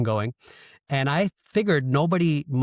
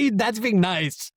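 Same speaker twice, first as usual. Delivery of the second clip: laughing out the words, much higher; 12 semitones higher, slower than usual. Real, not acted. Joking with the host about East Coast bias, laughing. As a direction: crack up while saying it.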